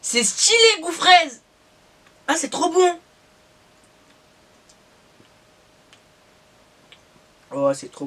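eating from a spoon; talking between spoonfuls; mouth full of yogurt. Real, not acted. Seen 3: Spoon at lips 0.6–0.9s, then scooping from cup throughout.

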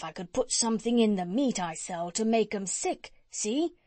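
chatty, eager tradesman voice; cat's meat man. Acted. Cat's meat man talking to the doctor, eager and folksy. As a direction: play a folksy meat seller pitching his idea.

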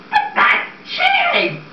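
very high, slow, airy squeak; squeaky protest. Acted. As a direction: squeak the line in disbelief. Max persuading Bloom to cook books.

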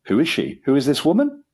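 high, alarmed questioning; sharp cry. A narrator's reading. Father hearing of his son's engagement, sharp cry. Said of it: "A sharp cry came from Lord Emsworth".

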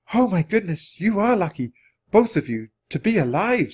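high, exclaiming woman; swooping, concerned exclamation; Florence's voice. A narrator's reading. Florence exclaims over the children.

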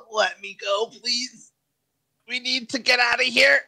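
high, strained plea to escape; pleading character yell. Pretend. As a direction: plead in a high, panicky put-on voice. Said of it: "Curtis just yells out".

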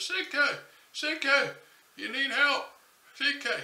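loud, high shout across the water; yelling his name. Acted. Narrator hollering for C K at the river. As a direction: holler for the man who slid under. Calling to a man who went under.

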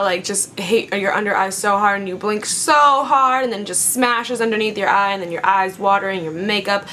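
ordinary talk, nothing marked

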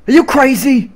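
hammed-up disbelieving yell; mock outraged yell. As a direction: shout it like a cartoon character losing it. Acted outburst, game-style quote.

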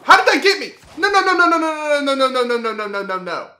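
high panicked no's, trailing off; frantic no's fading. Real, not acted. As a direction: shriek no over and over, then fade.

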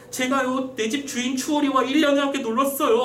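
high-pitched put-on voice of a beaten husband; confessing prisoner. Flogged Chunpung confesses to the official, high pleading voice.

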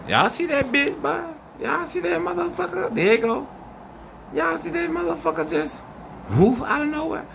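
high-pitched amazed calls to viewers; excited calling out. Real, not acted. Filming a strange light, he calls his viewers in excitement. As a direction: point it out to the viewers in sudden excitement.

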